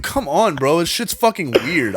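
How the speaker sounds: normal talking voice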